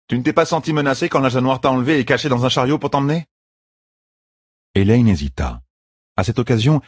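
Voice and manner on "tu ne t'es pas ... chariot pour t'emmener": higher-pitched, lightly voiced bodyguard; challenging bodyguard. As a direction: question her sceptically. Voices Birgit challenging Elaine.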